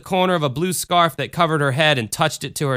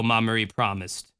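plain reading voice

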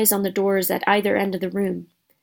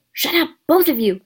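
higher, sharp reprimand; 6 semitones higher than usual. A narrator's reading. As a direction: cut off the bickering sharply. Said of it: "said Harry sharply".

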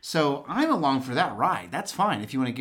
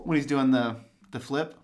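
ordinary delivery

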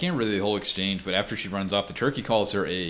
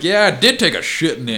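higher, louder retold retort; defiant mock admission; 6 semitones higher, 6 dB louder than usual. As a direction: throw it back as the defiant wife. Acts out wife's defiant admission.

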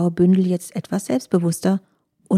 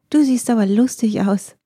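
higher, naive blurted remark; little fox blurting; 4 semitones higher, 3 dB louder than usual. A narrator's reading. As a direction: blurt it out without thinking. Füchsin spricht Gedanken aus. (kleine Füchsin platzt höher heraus)

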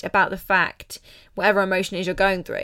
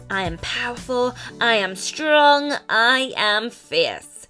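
slow, rising affirmation chant; booming motivational speech; 4 semitones higher, 4 dB louder, slower than usual. Shown as a demonstration. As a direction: proclaim each line slowly, louder on strong. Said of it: "Repeat after me".

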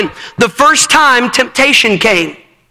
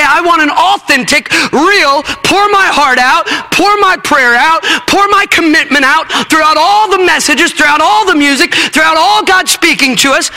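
higher, driving preacher voice; full-force preaching; 6 semitones higher than usual. Real, not acted. Preacher urging youth to commit, in full-force preaching. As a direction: preach it hard and build to a peak.

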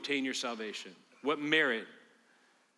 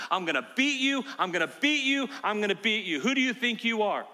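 loud, high mocking Satan taunt; 8 semitones higher, 6 dB louder than usual. Preacher voicing Satan, a loud high taunt. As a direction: hammer it in like Satan gloating.

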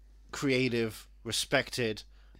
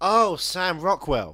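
louder, drawn-out exclamation; loud slow guess; 6 semitones higher, 10 dB louder, much slower than usual. Real, not acted. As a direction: call it out slowly.

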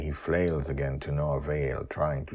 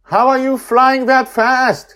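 very high, loud, slow shout; 17 dB louder than usual. Acted. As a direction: shout it across the wind. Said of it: "he shouts at us".

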